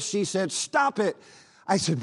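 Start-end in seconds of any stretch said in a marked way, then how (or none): 0.5-1.1 put-on higher, pleading girl's voice. Joyce begging the preacher again, higher pleading. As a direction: urge him to stop, pitched up. Possessed girl begging him.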